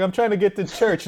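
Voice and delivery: high mock plea; pleading old man. Old driver explaining he is off to church, high plea. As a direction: say it like a baffled churchgoer.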